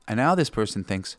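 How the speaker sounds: ordinary talk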